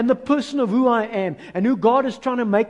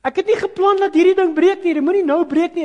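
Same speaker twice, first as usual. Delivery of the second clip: higher, louder grumbling rant; mock complaining; 9 semitones higher, 7 dB louder than usual. Preacher mimicking himself complaining when something breaks, louder and higher. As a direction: whine like a fed-up complainer. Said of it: "I'm the specialist complainer".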